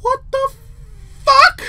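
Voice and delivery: high, loud shocked cry; real startled shriek of disbelief; frightened outburst. Real, not acted. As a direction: yell it in alarm after a scare. Reacting to jump scare.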